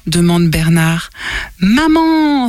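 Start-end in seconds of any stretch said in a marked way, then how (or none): 1.6-2.5 high bright cry of an idea; eager exclamation. Acted. Abel cries out that his mother knows how, high and eager. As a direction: shout it out as the answer. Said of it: "s'écrie Abel".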